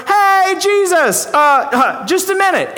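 high, loud call after a departing Jesus; crowd calling out. Acted. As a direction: shout after him to wait.

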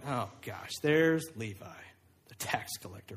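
low, hushed grumble on seeing someone despised; muttered dismay. Acted. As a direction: grumble it under your breath. Disciples spotting the despised tax collector.

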